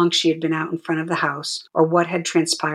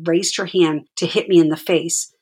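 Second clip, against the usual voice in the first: ordinary delivery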